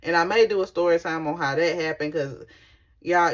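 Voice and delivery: ordinary delivery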